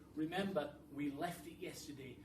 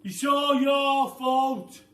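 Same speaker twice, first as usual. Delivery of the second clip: very loud, slow, higher furious yell; a loud, slow, furious shout; angry yell; 11 semitones higher, 22 dB louder, much slower than usual. Acted. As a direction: bellow the blame at his wife. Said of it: "yelled Mister Twit".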